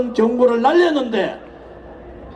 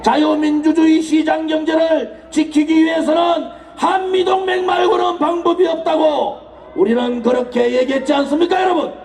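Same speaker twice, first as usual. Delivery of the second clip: roaring rallying speech; 4 semitones higher than usual. Acted. Political rally oration.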